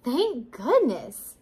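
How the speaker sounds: slow, sighing relief. A narrator's reading. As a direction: draw it out in a relieved sigh. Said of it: "said Woodchuck with a sigh".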